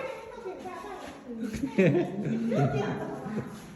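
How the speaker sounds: bursting into giggles; high laughing fit. Real, not acted. Laughs after fake scare.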